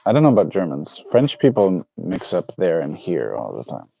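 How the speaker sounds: ordinary talk, nothing marked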